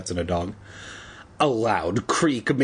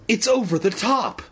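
high, alarmed mock cry; high-pitched cry; 9 semitones higher than usual. A narrator's reading. Evan alarmed at the monster blood, crying out high. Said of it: "Evan cried".

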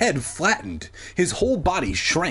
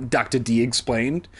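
usual speaking voice

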